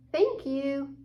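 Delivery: ordinary delivery, nothing marked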